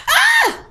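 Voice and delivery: put-on sudden high shriek like a startled boy; imitated girly scream. Son shrieking as mom lifts her t-shirt, mock high scream. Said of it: "he screamed like a girl".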